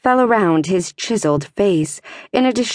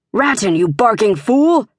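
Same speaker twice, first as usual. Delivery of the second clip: scolding shout; 3 semitones higher than usual. A narrator's reading. Searcher outside scolding Ratton, angry shout. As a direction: berate him from across the yard.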